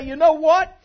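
hammed-up self-praise; mock boastful. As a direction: show off like you deserve a parade. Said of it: "I come in here this morning and say".

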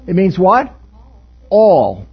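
a quick, loud Jersey-accented all. Real, not acted. Pastor repeating a congregant's answer in Jersey accent. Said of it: "Pardon my Jersey accent".

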